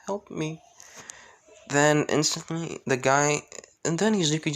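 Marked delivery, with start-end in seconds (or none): 0.1-0.6 soft, higher-voiced little plea; small, high voice imitating a tearful girl; mock crying plea. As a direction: plead quietly as a crying child, understated. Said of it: "then just crying".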